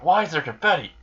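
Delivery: mock bewilderment, pitch jumping up; baffled. Baffled victim finds confetti.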